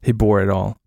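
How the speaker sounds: ordinary delivery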